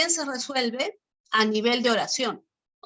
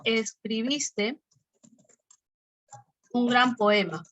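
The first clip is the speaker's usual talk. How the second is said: "escribiste" drawn out very slowly, then "un gran poema" with a fall on "gran"; slow example read; slower than usual. Shown as a demonstration. Teacher dictates the example sentence to students, slowly. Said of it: "¿Hay tono o no hay tono?".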